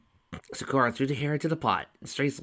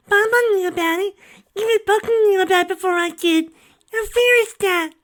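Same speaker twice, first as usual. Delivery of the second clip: put-on hurt child; 11 dB louder than usual. A narrator's reading.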